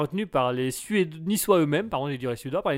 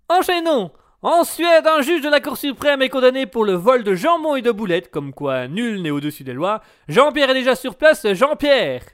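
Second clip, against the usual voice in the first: radio host; 4 semitones higher, 6 dB louder than usual. Acted. Radio host announcing the news.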